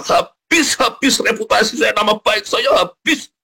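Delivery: very high, dramatic wail of ruin; despairing outcry. Retold or imitated. Preacher recalling his ruined reputation, despairing outcry. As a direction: relive the panic, pitched right up.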